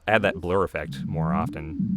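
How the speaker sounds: ordinary talk, nothing marked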